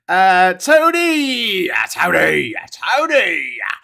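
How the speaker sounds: high, loud, stretched-out call of the name; delighted greeting. Acted. Streamer joyfully greeting a viewer named Tony. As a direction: hail him like an old friend.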